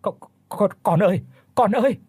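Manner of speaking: high, loud desperate call; desperate high shout into the night. Acted. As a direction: cry out to her child. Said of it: "đánh bạo mà thét lớn".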